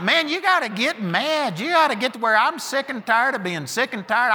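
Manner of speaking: ordinary talk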